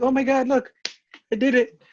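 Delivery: loud, high-pitched mock triumph; excited nurse impression. Nurse who found the vein calls out, mock excited. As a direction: imitate someone thrilled at their own success.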